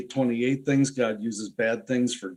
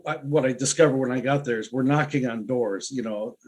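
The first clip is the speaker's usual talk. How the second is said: normal talking voice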